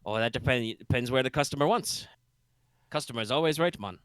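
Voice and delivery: a light Jamaican-bayou lilt, easygoing; vaguely Jamaican bayou accent. Acted. Boat captain greets the party, lightly Jamaican bayou accent. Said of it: "vaguely Jamaican bayou accent".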